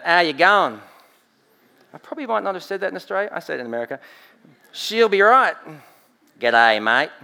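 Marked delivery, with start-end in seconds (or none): 0.0-0.8 put-on Australian accent. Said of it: "Even in my accent". 4.7-7.1 hammed-up Aussie slang, loud and broad; Australian accent. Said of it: "Even in my accent".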